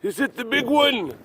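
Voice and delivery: high, slow startled cat voice. Retold or imitated. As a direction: play a groggy startled cat. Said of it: "He's like".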